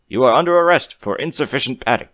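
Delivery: loud, firm arrest order; stern guard. A narrator's reading. Guard pony arresting the human, loud and firm.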